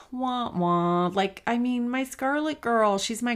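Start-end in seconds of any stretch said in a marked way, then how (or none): 0.0-1.1 sigh, then a mock childish "wah, wah"; mock whine. Mother missing her daughter at Christmas, mock whining. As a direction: whine like a sulking kid, half joking. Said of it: "But I'm like".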